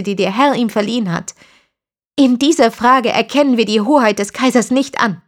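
a hint of fierce conviction; defiant young woman. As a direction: stand your ground against the interrogator. Christina defies Berthold.

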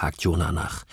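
plain reading voice

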